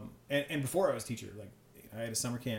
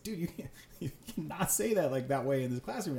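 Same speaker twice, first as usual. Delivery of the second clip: retold scolding; 4 semitones higher than usual. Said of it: "I was, like, pissed".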